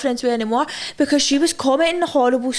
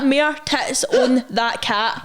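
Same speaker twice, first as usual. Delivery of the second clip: "More tits on that cat" read out slowly, word by word, with a laugh; slow quote; much slower than usual. Shown as a demonstration. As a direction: slowly spell out "More tits on that cat" as the comment was written. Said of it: "He actually spelled it like".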